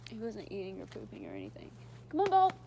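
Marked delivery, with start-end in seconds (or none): 2.1-2.5 much higher and louder call. Real, not acted. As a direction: call the dog from across the yard. Calling the dog over.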